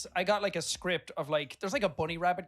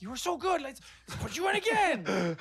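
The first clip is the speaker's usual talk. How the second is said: much higher and gushing; gushing studio voice; 12 semitones higher, 5 dB louder than usual. Retold or imitated. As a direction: rave like a thrilled producer. Studio praising him, quoted.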